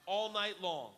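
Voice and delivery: ordinary talk, nothing marked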